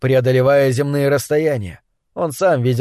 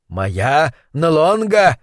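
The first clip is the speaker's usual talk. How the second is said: slow, boastful self-announcement; much slower than usual. Acted. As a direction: brag about your name, slowly. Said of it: "в обычной своей хвастливой манере".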